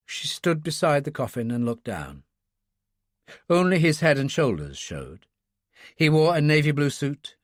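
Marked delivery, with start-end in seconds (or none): none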